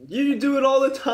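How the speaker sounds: loud. Real, not acted. Teasing friend who balks.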